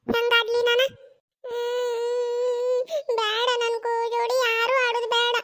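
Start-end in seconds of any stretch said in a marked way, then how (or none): 1.4-5.4 drawn-out whimpering refusal; sulky, wailing child; crying. Acted. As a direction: whine through a long tearful hum. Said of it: "ಸುಮ್ಕಿರು, ಅಳಬಾರ್ದ".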